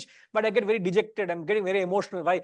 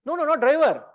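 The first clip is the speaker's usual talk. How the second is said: louder, higher protest, half joking; excited protest; 7 semitones higher, 5 dB louder than usual. Real, not acted. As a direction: cut in quickly, brushing off the idea. Laughing protest about driving.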